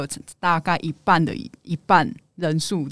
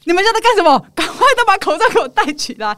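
shouting; 11 semitones higher, 5 dB louder than usual. Retold or imitated. Scolding family, no masks.

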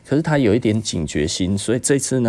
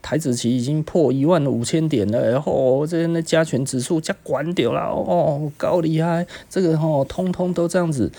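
higher mock-excited hype; mocking stock hype; 7 semitones higher than usual. Mocks people bragging that stocks keep rising, higher and mock-excited. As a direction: sarcastically parrot the market cheerleaders. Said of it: "很多人一直在讲".

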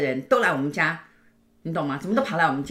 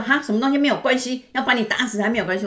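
mock angry mother; 6 semitones higher, 4 dB louder than usual. Mother angrily retorting.

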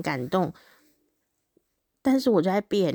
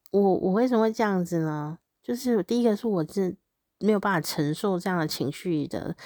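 ordinary talk

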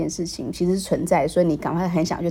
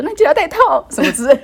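higher, louder and fast, in a put-on voice; mock mom; 11 semitones higher, 8 dB louder, faster than usual. Host imagining mom reminding her to use condoms, quick high mock voice. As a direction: rattle off a mom's reminder. Imagining mom's final concession.

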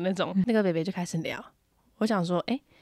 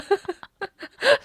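laugh; 13 semitones higher, 3 dB louder than usual. Real, not acted. Laughing at own story.

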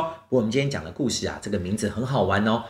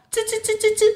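much higher squeaky 吱吱 monkey chatter. Acted. Storyteller imitates a chattering monkey for the kids. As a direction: chatter like a little monkey, way up high. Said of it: "这个动物它的声音是这样子哦".

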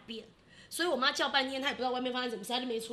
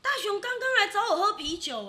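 higher and louder, a lightly voiced dad; excited dad; 9 semitones higher, 9 dB louder than usual. Dad eagerly telling mom his late son-in-law came by, lightly voiced. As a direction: mimic dad's eager news.

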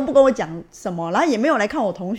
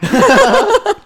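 burst of laughter; 13 semitones higher, 9 dB louder than usual. Real, not acted. Laughing at the absurd moment.

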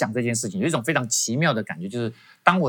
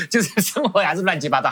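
amused, high-pitched confession; laughing at yourself as you admit it; laughing self-mockery; 6 semitones higher than usual. Real, not acted. Laughs at his own messy life.

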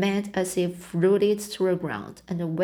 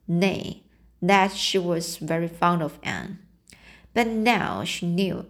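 ordinary delivery, nothing marked

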